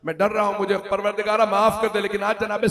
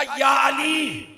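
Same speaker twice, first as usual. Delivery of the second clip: high, loud preaching wail; loud lament; 7 semitones higher, 7 dB louder, much slower than usual. Acted. Preacher telling of Gabriel and the angels to mourners, loud wail. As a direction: proclaim the angels' grief in full voice.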